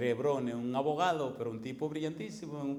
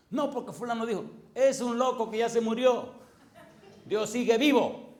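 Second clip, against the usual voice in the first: loud, higher mocking line; mocking retort; 8 semitones higher, 7 dB louder than usual. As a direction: jeer at the teacher. Angry student mocks the teacher. (burla fuerte y aguda)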